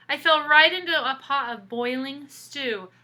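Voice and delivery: put-on lively read-aloud for kids; storybook reading. A narrator's reading. Teacher reading a picture book to children, animated storytelling. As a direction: tell the story to the class with expression.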